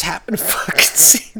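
breathy, giggling whisper; stifle a laugh as you tell it. Real, not acted. Laughing while telling.

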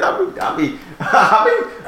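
breathy high giggle through words; laughing. Real, not acted. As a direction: laugh while stammering it out. Laughing at own confession.

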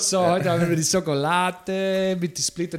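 broken German with Italian lilt; fake Italian accent. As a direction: play a hammy Italian gelato man. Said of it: "Fake-Italienisch gesprochen".

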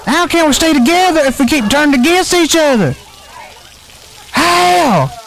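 earnest plea. Acted. Pleading speech to calm fight.

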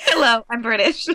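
mock British greeting; mimicked British accent. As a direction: playfully copy a British introduction. Host mimics guest's British intro.

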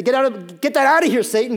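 forceful mock dismissal; firm rebuke. Acted rebuke of Satan.

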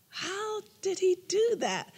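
high, slow amazed "how did he do that"; wide-eyed disbelief, drawn out; mock astonishment in a high voice; amazed question. Retold wonder at husband.

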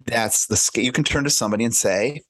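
usual speaking voice

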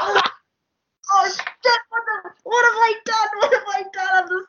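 high, panicked imitation with a laugh; mock panic. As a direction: squeal out the horror of the blunder. Retold panic, high, shout.

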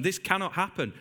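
ordinary talk, nothing marked

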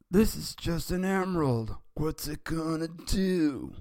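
high, sneering cartoon bully; Nelson. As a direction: scoff in a high put-on voice, stretching the end. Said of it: "And Nelson said".

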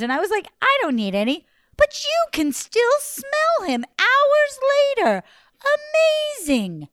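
high, gushing flattery; butter him up sweetly; flattering. Acted. Lady flattering the giant.

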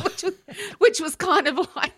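high, laughing delivery. Real, not acted. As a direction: squeak out a laugh. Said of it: "laugh heard, high".

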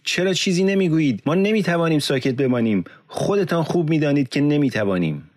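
very high, impassioned challenge; narrated roar, urgent and high; roaring at crowd. A narrator's reading. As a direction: rouse a silent crowd, pitch soaring. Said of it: "به سوی جمعیت رو کرد و غرید".

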